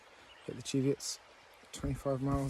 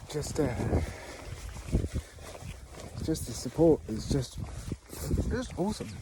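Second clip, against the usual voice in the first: teary; 6 dB quieter than usual. Real, not acted. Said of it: "oh, I'm gonna cry".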